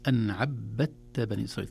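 عبدت with a clearly doubled ba; عبدت said ʕabbatt. Shown as a demonstration. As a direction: press the ba in عبدت. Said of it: "تشديد الباء وتشديد التاء".

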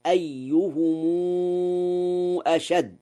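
"أيهم" with the plural mim joined by a long held u before "أشد"; ميم الجمع بالصلة. Shown as a demonstration. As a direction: draw out a long "oo" after "أيهم", then "أشد". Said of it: "وله صلة ميم الجمع". (صلة ميم الجمع في "أيهم" بواو ممدودة طويلة)

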